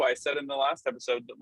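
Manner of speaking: usual speaking voice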